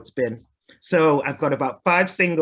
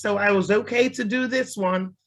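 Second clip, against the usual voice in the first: ordinary talk, nothing marked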